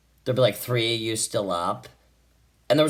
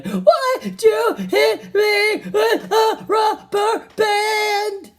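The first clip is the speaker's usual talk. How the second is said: put-on very high, slow, wailing kid voice; crying kid; 4 dB louder, much slower than usual. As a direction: sob it out like a kid in a fight. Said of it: "they'd be crying".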